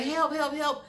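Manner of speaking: scaled-down, high-pitched pleading; retold scream for help. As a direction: imitate a friend's repeated cries for help. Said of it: "she's screaming".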